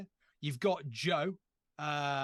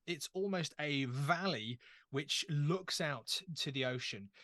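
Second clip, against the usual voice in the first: usual speaking voice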